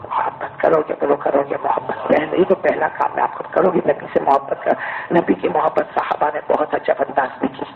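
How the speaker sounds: ordinary talk, nothing marked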